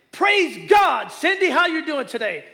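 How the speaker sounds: calling out warmly and loud; a booming hello to a stranger; loud cheerful greeting. Retold or imitated. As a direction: greet her loud like an old friend. Mentor loudly greets clerk by name.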